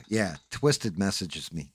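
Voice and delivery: ordinary delivery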